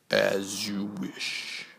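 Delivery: slow hushed whisper; Vader whisper. Acted. Vader giving in to the twins, slow whisper. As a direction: concede softly, dark and slow.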